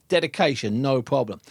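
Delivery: normal talking voice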